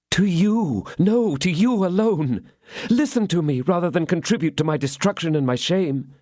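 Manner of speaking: high, slowed emphatic begging; only he can save her; insistent plea. Acted.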